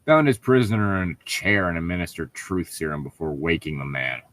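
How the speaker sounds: ordinary talk, nothing marked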